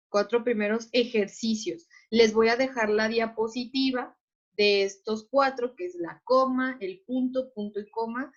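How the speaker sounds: ordinary delivery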